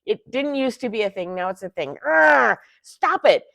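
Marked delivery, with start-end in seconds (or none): 2.1-3.4 loud, higher groan then snap; real exasperation boiling over; frustrated outburst. Real, not acted. Fed-up shopper scolding websites, loud frustrated outburst. Ranting at online shopping sites.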